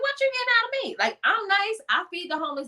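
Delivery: high-pitched comic self-defense; indignant protest. Retold or imitated. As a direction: plead your case in a squeaky, offended voice. Retold pleading argument with God.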